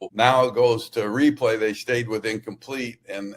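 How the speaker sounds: ordinary talk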